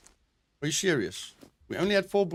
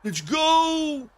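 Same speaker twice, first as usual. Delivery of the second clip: excited yell; 10 semitones higher than usual. Real, not acted. Fight cheer.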